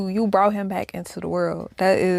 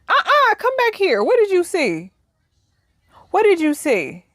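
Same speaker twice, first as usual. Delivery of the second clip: alarmed mom; 8 semitones higher than usual. Pretend. Acting out mom questioning child.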